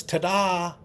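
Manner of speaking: high bright ta-da like a magic trick; magician's ta-da. Pretend. Memory-palace magician unveiling a trick, showman's ta-da. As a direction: announce it like a magician revealing a trick.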